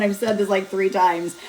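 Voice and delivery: usual speaking voice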